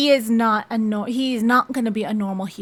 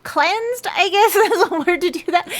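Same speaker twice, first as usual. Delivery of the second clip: laughing at the word choice; 8 semitones higher, 5 dB louder than usual. Real, not acted. Unsure of her word choice.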